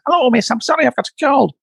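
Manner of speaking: squeaky Lancastrian impression, far above normal voice; high-pitched Lancastrian accent. Imitating a student reciting the parrot sketch, high-pitched Lancastrian accent. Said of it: "in a very high-pitched Lancastrian accent".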